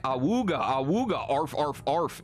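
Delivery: cartoon "awooga" horn, then barks; mock howling and barking. Streamer reading a chat tease about giant breasts, cartoon awooga and barks. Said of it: "Akuno goes like".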